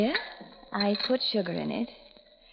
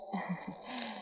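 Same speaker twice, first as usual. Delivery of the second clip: soft sinister chuckle; 4 semitones lower, 15 dB quieter than usual. Acted. Villain gloats quietly.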